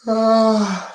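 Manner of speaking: sudden high yelp; loud high yelp. Real, not acted. Loud high vocal noise.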